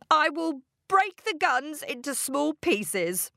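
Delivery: high, pleading vow; earnest promise. A narrator's reading. Father promising for his life.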